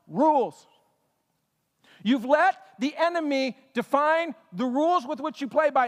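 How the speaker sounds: high, earnest pleading voice; a pastor begging his people to see; pleading Paul. Acted. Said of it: "he's pleading".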